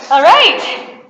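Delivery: high, loud cheer; excited. Real, not acted. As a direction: celebrate the finish. Cheer at the end of class.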